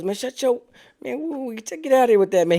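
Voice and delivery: high-pitched mock comeback; retold dismissal. Porter tells rival to get out, retold dismissal. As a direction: imitate an indignant brush-off, voice lifted.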